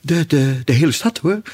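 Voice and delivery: high, stammering nervous king; jittery, shaking voice; nervous, trembling voice. A narrator's reading. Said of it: "Hij was heel nerveus en zijn stem bibberde helemaal".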